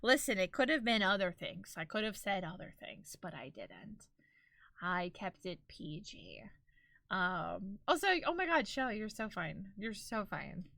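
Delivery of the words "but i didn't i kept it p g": low, very soft aside; understated defense. Real, not acted. Telling chat she held back, hushed and low. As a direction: drop right down, quiet and low. Mock-demure defense of mild threat.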